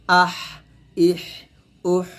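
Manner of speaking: "আহ, ইহ, উহ" one at a time, each ending in breath; breathy final হ. Shown as a demonstration. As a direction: let air flow out after "আহ, ইহ, উহ". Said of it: "তো এখানে বাতাস হবে".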